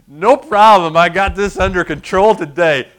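mock-smug confidence; cocky boast. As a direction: brag with your feet up. Pastor boasting feet on desk.

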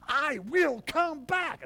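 loud, slow, high proclamation; slow ringing declaration of return; booming promise. Shown as a demonstration. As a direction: thunder it out but not in anger. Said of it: "not saying that in an angry tone".

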